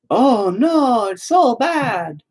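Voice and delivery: high, slow whiny mock "oh, no"; mocking offended whine. Mocking people hurt by his opinions, high whiny mock. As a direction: ham up a hurt, oversensitive person.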